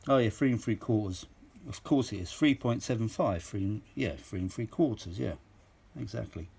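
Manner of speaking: ordinary talk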